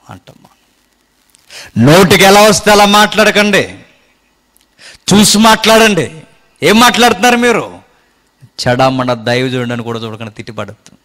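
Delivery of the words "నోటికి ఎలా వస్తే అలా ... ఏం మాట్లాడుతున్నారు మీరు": higher, louder pulpit preaching; fervent preaching. Acted. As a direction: preach it out to the congregation. Preaching at full force.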